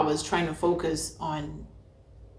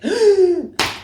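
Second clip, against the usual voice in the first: loud, high acted gasp; startled-awake gasp; 12 semitones higher, 5 dB louder than usual. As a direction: gasp as if jolted out of sleep. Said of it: "when you're sleeping and then you're like".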